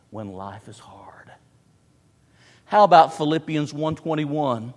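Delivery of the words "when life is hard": whispered softly; hushed. Real, not acted. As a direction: let it fall to a whisper. Hushed reflection.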